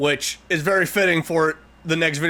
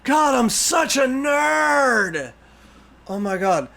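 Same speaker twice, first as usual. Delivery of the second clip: giddy outburst; 9 semitones higher, slower than usual. Real, not acted. Fan delighted at own guess.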